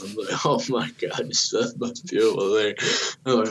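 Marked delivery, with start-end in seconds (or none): 0.5-2.7 mock bawling. New dad bawling at the birth, mock tearful. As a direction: imitate yourself blubbering over the baby. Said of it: "fucking bawling like always".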